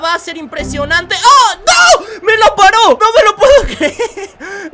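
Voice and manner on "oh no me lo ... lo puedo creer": loud, high-pitched wail of defeat; disbelieving shout. Acted. Gamer watches his panenka get saved, disbelieving shout. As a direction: cry out as the sure goal is stopped.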